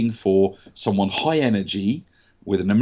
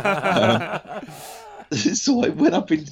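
laughing; 10 semitones higher, slower than usual. Real, not acted. Laughs at British cake remark.

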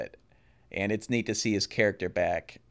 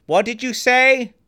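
high, loud, slowed shout of a man who can't hear; loud half-deaf boss; 10 dB louder, slower than usual. Retold or imitated. Imitating the half-deaf boss Gordon Cole, loud and high. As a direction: bellow it like someone going deaf. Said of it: "he talks like this".

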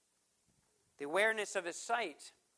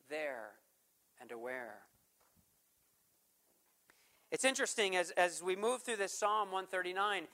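ordinary talk

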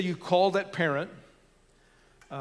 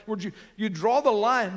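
ordinary talk, nothing marked

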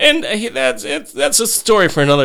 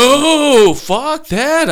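high, loud, drawn-out protest; emphatic denial; 13 semitones higher, 5 dB louder, much slower than usual. Real, not acted. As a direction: shoot the idea down at high pitch. Rejects idea he admitted it.

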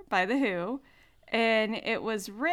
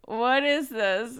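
slow, insistent mock bafflement; baffled kid; 2 semitones higher, 4 dB louder, slower than usual. Imitating son rejecting the song, baffled. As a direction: quote a put-out child, drawing it out. Repeats her kid's baffled reaction.

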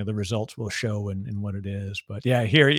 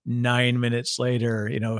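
ordinary delivery

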